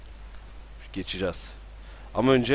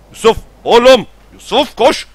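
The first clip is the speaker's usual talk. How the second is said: high, loud desperate yell; urgent shout; 11 semitones higher, 13 dB louder than usual. Real, not acted. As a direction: yell at him to run. Karakterine koşmasını bağırıyor. (çaresizce bağırış)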